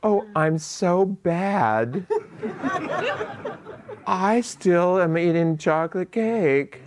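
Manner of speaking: high, slow, giggly self-scolding; mocking someone who thinks chocolate cake is a sin; mock guilty confession. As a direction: play the naughty child confessing, laughing. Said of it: "and then saying".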